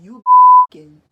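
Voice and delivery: sudden mock scream of the accusation; loud outraged shriek; mom yelling.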